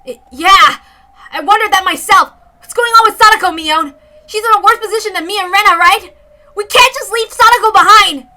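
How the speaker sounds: high, loud, urgent protest; high loud alarmed plea; alarmed shout. Acted. Alarmed about Sadako, arguing.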